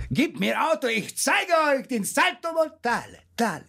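put-on high, swaggering kid voice; cocky boast. Bragging to his friends, a cocky high boast. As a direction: brag like a reckless teenager.

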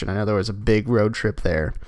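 usual speaking voice

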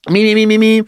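warming up the voice; quick singer warm-up syllables; vocal warm-up. Shown as a demonstration. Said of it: "clears throat".

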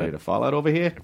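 put-on joking Boston accent; lightly put-on Boston accent. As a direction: play along in mock Bostonian. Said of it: "I talk like that".

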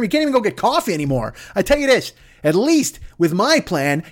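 ordinary talk

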